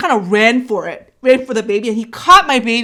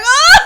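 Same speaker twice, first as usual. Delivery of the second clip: high, loud "oh"; acted cry of pain; labour cry; 9 dB louder than usual.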